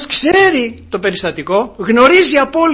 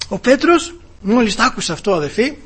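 ordinary talk

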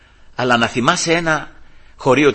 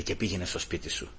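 very soft, low acted advice; gentle counsel; 6 semitones lower, 16 dB quieter than usual. Judge tells wife to go home, gentle counsel. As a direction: hush down as a kindly judge.

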